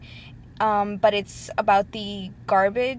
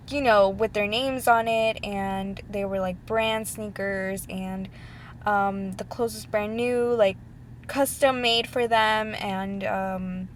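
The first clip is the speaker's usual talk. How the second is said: ordinary delivery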